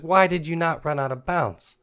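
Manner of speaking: frustrated quarterback. Retold or imitated. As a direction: scold your teammate. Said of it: "He's like".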